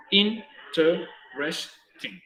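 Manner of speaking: interesting drawn out slowly, in-te-res-ting; slow four-syllable interesting. Shown as a demonstration. Teacher slowly models the word for students. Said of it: "Interesting tiene también four".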